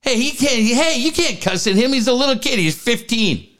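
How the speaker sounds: high-pitched protesting parent; Rick Moranis impression. Parent defending a fifteen-year-old from cussing, high mock protest. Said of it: "the one parent, you know, probably Rick Moranis".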